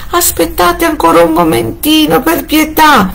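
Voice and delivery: wife pleads with her husband for time; stifled voice. A narrator's reading. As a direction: beg in a strangled voice. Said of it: "con voce soffocata".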